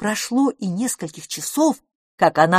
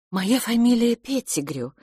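higher, tentative introduction; apologetic; 5 semitones higher than usual. A narrator's reading. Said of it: "сказала она извиняющимся тоном". (извиняющимся тоном)

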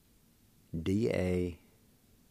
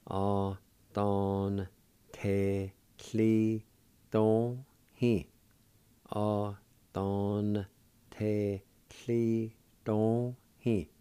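syllable by syllable; much slower than usual. Shown as a demonstration. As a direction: pause after every syllable. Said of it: "pronounce that N sound to the A like this".

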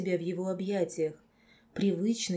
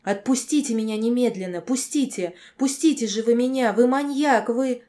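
screaming higher and louder; terrified horror victim; 5 semitones higher, 8 dB louder than usual. A narrator's reading. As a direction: shriek in panic to be let go. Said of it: "сорвалась на крик".

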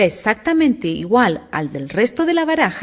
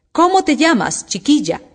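imperious; 7 semitones higher, 4 dB louder than usual. A narrator's reading. Impatient queen questions Alicia.

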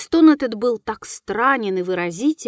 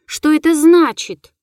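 loud, high frantic demand; frenzied cry; 6 semitones higher, 8 dB louder than usual. A narrator's reading. Руневский demands meaning from the ghost, crying out. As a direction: cry out the question, raised and urgent. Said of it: "закричал он в выступлении".

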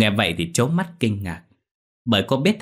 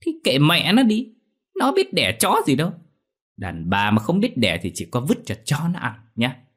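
contemptuous; 7 semitones higher than usual. Acted. Son snaps back at mother.

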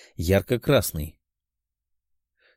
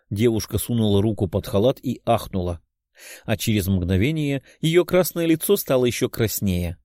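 plain reading voice

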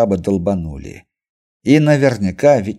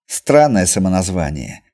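ordinary delivery, nothing marked

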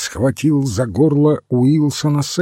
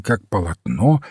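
usual speaking voice

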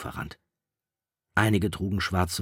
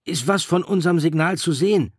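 worried; 12 semitones higher, 8 dB louder than usual. A narrator's reading. Piggy asking Ralph anxiously about the signal. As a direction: press him with rising worry. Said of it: "fragte Piggy besorgt".

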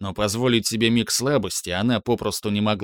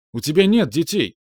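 almost shouting; 6 semitones higher, 4 dB louder than usual. Acted. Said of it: "едва не крича продолжал Луис".

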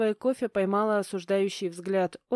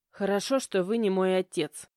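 plain reading voice

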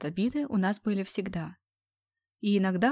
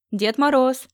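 jokey shout; 5 semitones higher, 8 dB louder, slower than usual. Acted. Friend jokes at the door.

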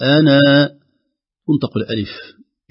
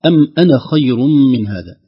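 a touch louder recitation with أنا stressed; recited verse; 4 semitones higher, 6 dB louder than usual. Shown as a demonstration. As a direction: recite as a tajweed example. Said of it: "كلمة أنا". (تلاوة واضحة مع إبراز أنا)

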